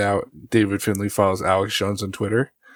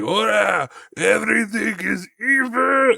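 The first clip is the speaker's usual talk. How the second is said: high, drawn-out mock finishing cry; mock wrestling yell without full volume; imitated wrestler's yell; 10 semitones higher, slower than usual. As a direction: imitate his victory holler, stretched out and pitched up. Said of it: "he yells out when he finishes".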